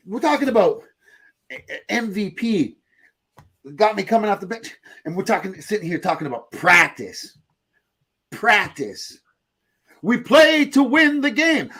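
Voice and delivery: high, indignant press-conference rant; mock sports rant. Imitating famous sports press-conference rants.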